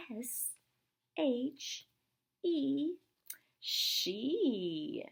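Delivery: high, dainty letter-by-letter spelling; fancy voice. Shown as a demonstration. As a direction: spell it all fancied up. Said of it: "Get all fancied up".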